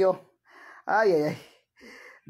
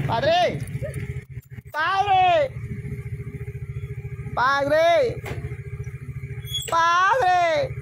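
calling out; 6 semitones higher, much slower than usual. Real, not acted. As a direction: call Padre out across the house. Calling father repeatedly.

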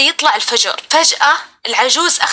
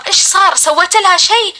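urgent retold questioning, pitched up; higher-pitched, pressing mock interrogation; alarmed interrogation; 6 semitones higher than usual. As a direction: act out worried relatives demanding answers. Alarmed father and brother interrogate.

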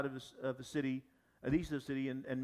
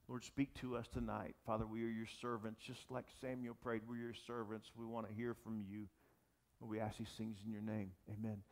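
hushed prayer; 4 semitones lower than usual. Acted. Pastor prays over congregation.

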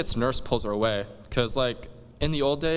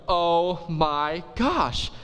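very slow, higher gasping oh my gosh; giddy surprise; 5 semitones higher, much slower than usual. Acted. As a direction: gush in slow disbelief. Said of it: "she's like".

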